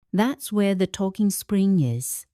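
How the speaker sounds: ordinary delivery, nothing marked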